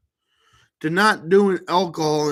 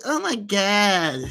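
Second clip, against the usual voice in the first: drawn-out, higher mocking "nag" imitation; mock nagging woman; 4 semitones higher, slower than usual. Man mocking how women greet him, mock nagging. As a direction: imitate a nag, dragging out the words. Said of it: "You sound like a nag".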